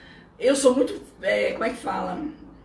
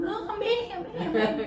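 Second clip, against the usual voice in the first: high, light imitation of a congregation's lukewarm amens; mock the polite, unconvinced agreement; wry, high-pitched mock assent; half-hearted amen; 10 semitones higher than usual. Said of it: "Aquela força".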